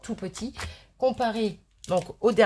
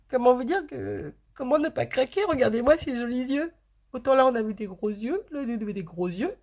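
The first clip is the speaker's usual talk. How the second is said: higher, doting cutesy voice; 4 semitones higher than usual. Real, not acted. Vlogger gushing over the figures' pretty eyes, gaga baby talk. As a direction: coo over them like a besotted fan. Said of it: "je suis tellement gaga que je, je parle comme une, euh, gaga".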